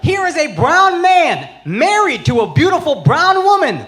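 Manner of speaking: fired-up comic rant; ranting shout. Real, not acted. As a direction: yell it at the crowd like a closing argument. Ranting shout about Apu.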